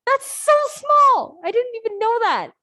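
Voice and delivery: high, loud burst of surprise; amazed exclamation. Real, not acted. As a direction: stretch it out in real disbelief. Teacher surprised by tiny print.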